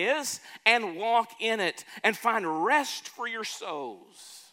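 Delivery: slow, swelling preacher's voice; preaching. Acted. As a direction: lean into it like a pulpit sermon. Reading scripture in pulpit voice.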